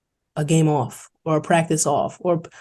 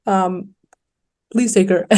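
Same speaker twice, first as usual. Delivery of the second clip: mild pleading, pitched up a little; retold plea, slightly higher and more pressing; begging coach; 4 semitones higher, 3 dB louder than usual. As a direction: imitate a coach lightly pleading for a player. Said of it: "coach really begged Manhattan".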